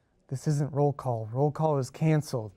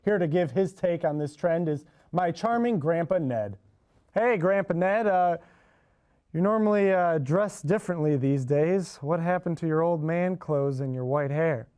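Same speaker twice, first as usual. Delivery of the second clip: TV host; 4 semitones higher than usual. Acted. TV host presenting segment.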